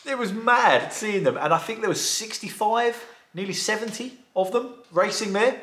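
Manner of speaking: bubbling over with excitement, voice up high; excited. Real, not acted. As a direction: gush about the race like it's the best thing you've seen. Excitedly describing kids' pedal car race.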